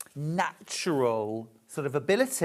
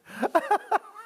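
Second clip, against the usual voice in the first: bursts into a high real giggle. Real, not acted. Host laughing at mixing up the cork, real giggle. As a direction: laugh at your own silly mistake. Laughs at her own mix-up.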